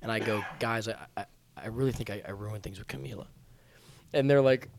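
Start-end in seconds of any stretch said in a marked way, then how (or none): 0.6-3.3 low, soft, hesitant confession; feigned lovesick confession. Acted. Putting on heartbreak to fool the girls, lovesick. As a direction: falter softly, as if truly smitten. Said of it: "I'm a decent actor".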